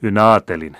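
Ynnä aatelin thrown back loud and firm; loud agreement. A narrator's reading. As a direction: take their grumble and proclaim it. Colonel repeats the objection aloud.

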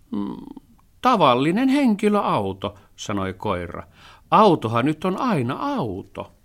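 excited hunting dog; Musti the dog. A narrator's reading. (innokkaan koiran ääni)

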